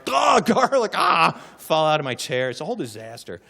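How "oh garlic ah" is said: mock disgusted cry. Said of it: "I'm like".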